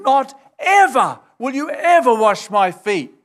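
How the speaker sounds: flat-out refuse, leaning on every "ever"; emphatic refusal. Retold or imitated. Peter refusing to let Jesus wash him, emphatic. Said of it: "a double negative there".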